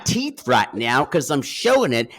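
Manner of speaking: loud, hammed-up actor impression; Gary Busey. Co-host showing off teeth at the microphone, hammed-up celebrity voice. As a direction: chew on the words, manic and loud. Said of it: "This is my Gary Busey".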